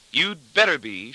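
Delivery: high, slow, gloating triumph; triumphant gloating. A narrator's reading. Wife gloating over her husband, triumphant. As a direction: draw it out with smug triumph. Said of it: "she'll gloat triumphantly".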